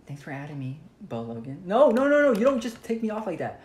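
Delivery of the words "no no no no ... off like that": high loud protest; yelling. Real, not acted. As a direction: yell in outrage. Protest at game event.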